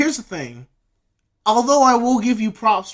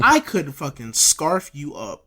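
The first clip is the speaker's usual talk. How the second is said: bored bear; 8 semitones lower than usual. Pretend. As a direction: drag it out like a weary big animal.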